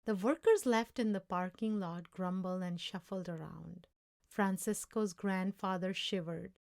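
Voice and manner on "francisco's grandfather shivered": ordinary delivery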